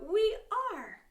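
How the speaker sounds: high, loud call; big cheery group shout. Shown as a demonstration. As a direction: shout it out for the kids. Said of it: "and shout".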